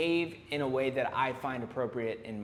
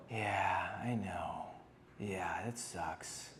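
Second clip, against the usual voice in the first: mock dejected; 8 semitones lower, 7 dB quieter, much slower than usual. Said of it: "And I go".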